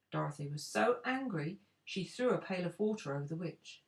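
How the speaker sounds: usual speaking voice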